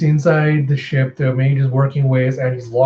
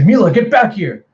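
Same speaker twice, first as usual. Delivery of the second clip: high, loud urgent call; acted alarmed shout; 7 semitones higher, 6 dB louder than usual. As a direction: call a child back from danger. Captain calls the girl back.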